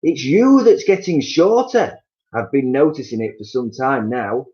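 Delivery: husband taunting his wife with a grin; Mister Twit taunting. Acted. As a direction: savour every word of the mockery. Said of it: "grinning horribly".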